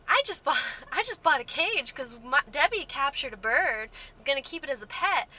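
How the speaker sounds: not-my-real-voice mock cheer; sarcastically happy. Shown as a demonstration. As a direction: gush with obviously fake happiness. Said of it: "that's obviously not my tone of voice".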